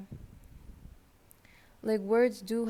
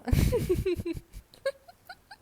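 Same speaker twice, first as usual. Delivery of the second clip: high laugh; 10 semitones higher, 8 dB louder than usual. Real, not acted. Caught herself and bursts out giggling. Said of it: "I'm just laughing".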